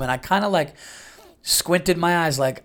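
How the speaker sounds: usual speaking voice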